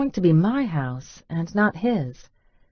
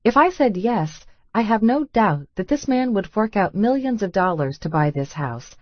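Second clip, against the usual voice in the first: normal talking voice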